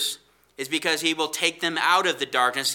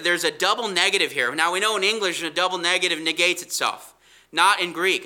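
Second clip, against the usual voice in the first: ordinary delivery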